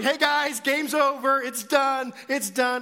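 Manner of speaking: high, loud call to the group; yelling. Retold or imitated. Counselor calling off the prank to kids, yelling. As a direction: yell over everyone to stop the game. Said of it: "I start yelling".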